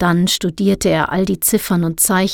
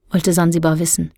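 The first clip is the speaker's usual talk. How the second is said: usual speaking voice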